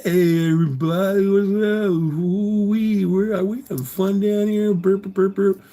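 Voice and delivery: put-on high, slurred party drunk; fake drunk. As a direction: slur it like you're sloshed. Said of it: "I'm slurring my words".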